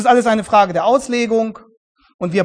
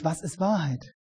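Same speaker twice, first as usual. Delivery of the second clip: softer, pensive musing question; thoughtful; 3 semitones lower, 10 dB quieter than usual. Shown as a demonstration. Pilate asking Jesus, quiet and thoughtful. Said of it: "Vielleicht fragt er ja nachdenklich".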